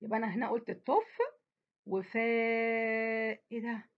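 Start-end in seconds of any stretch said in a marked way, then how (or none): 1.9-3.4 وفاء with the long aa held very long; teacher stretching the long vowel of وفاء. Shown as a demonstration. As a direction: hold the aa in وفاء. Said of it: "ده أنا مديت في الفاء التانية".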